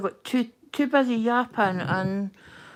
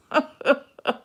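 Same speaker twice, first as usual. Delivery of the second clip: strained laugh; 4 semitones higher, 8 dB louder than usual. Real, not acted. Laughs at her own muddle.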